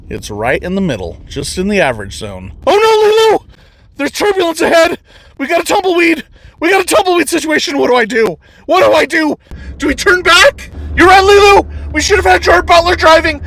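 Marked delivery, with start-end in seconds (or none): 2.7-8.4 high, loud hammed-up panic, shouting; frantic pretend pilot losing it; mock panic. Reviewer panicking at the turbulence, hammed-up high shouting. 8.7-9.4 higher, louder second plea; hammed-up panic rising; mock panic. Reviewer asks Lilu again, higher and louder. 9.8-13.4 high, loud hammed-up panic, shouting; frantic pretend pilot losing it; mock panic. Reviewer panicking at the turbulence, hammed-up high shouting.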